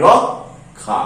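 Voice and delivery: রক্ষা as rok, pause, kha, pitched low; syllable-by-syllable pronunciation. Shown as a demonstration. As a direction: split রক্ষা in two, dropping low on kha. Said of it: "এর উচ্চারণ কি করি?".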